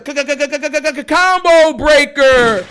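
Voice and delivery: machine-gun stutter, then a big arcade announcer call; game announcer. Retold or imitated. Gamer imitating Killer Instinct, stuttered announcer shout.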